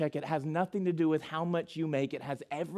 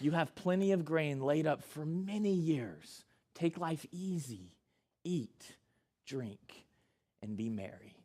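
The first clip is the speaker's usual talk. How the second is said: fading softer into a lazy sigh; easygoing self-talk; 6 dB quieter than usual. Acted. Rich man telling himself to relax, fading softer. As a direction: lull yourself into taking it easy.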